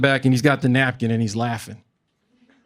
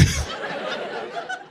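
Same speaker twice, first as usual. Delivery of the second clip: high wheezing laugh; 8 dB quieter than usual. Retold or imitated. Shrill laugh after punchline.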